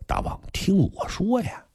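plain reading voice

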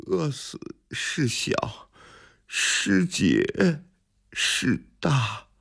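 high, faint, halting delivery; starving scholar squeezing out a proud maxim; weakly, with long gaps between phrases; feeble. A narrator's reading. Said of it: "有气无力地说".

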